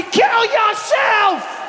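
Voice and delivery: high, drawn-out comic scream; mock outraged yell.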